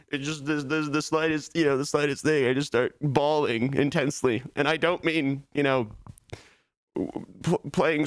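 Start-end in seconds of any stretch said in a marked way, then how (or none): none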